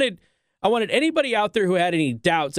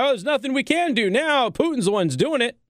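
higher mocking shrug; mock Biden; 6 semitones higher than usual. Host parodying Biden's answer, higher mock voice. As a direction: play it as a lame excuse. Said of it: "He's like".

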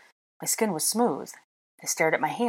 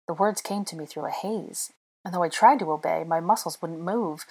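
usual speaking voice

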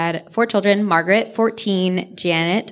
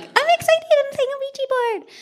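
put-on high, squeaky little girl voice; cute eleven-year-old. Imitating Janet as an excited eleven-year-old girl. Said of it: "cuter and, like, eleven-year-old-y".

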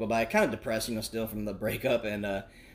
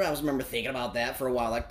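ordinary delivery

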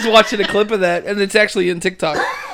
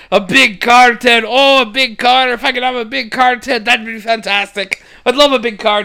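higher, loud, gushing excitement; excited Dougal; 3 semitones higher, 6 dB louder than usual. Retold or imitated. Dougal dreaming of a big car, gushing with excitement. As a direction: gush over it like a thrilled simple priest.

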